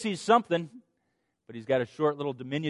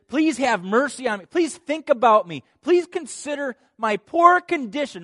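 higher, louder begging; pleading; 9 semitones higher, 8 dB louder than usual. Acted. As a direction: ask for mercy with urgency. Voicing a plea for mercy.

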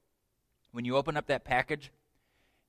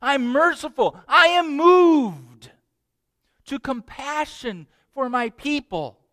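much higher, slow, moved voice; tender God; 11 semitones higher, 8 dB louder, slower than usual. Acted. Preacher voicing God telling Moses he feels their pain, high and moved. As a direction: let the compassion swell up and carry. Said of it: "God says".

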